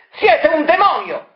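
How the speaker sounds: brand him a demon; accusing cry. Acted. Marella raving at Variconi.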